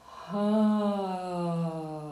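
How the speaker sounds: a drawn-out breathy "ha."; long breathy ha. Shown as a demonstration. Coach repeating the exercise, long breathy "ha.". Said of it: "and out on".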